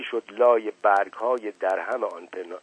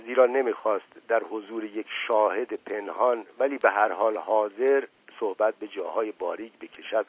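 plain reading voice

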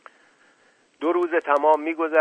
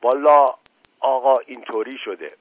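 curt, dry reply; 2 semitones higher, 7 dB louder than usual. Acted. Mash Qasem answering the colonel curtly and dryly. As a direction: answer flatly, giving nothing. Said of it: "با لحن خشکی گفت".

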